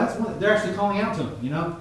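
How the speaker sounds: usual speaking voice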